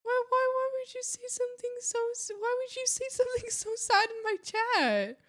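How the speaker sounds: high, whining complaint at chat; hammed-up wounded protest; mock outraged whine. Mock outrage at a sad chat message.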